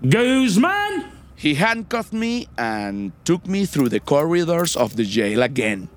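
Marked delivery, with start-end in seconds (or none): 0.1-1.0 high yelled name call; alarm shout. Retold or imitated. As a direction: bark the name like a guard. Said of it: "a guard yells".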